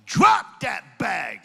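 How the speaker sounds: slow, loud, drawn-out command; call-out shout. Shown as a demonstration. Preacher leading the congregation in a loud slow chant. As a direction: bark each word for the crowd to echo. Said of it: "say it the way I do it".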